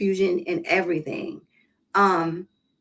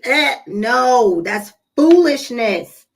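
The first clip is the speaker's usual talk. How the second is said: louder, slow, dismissive no; scornful dismissal; 3 semitones higher, 6 dB louder, much slower than usual. Real, not acted. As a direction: wave the idea off with scorn. Dismissing the idea, loud.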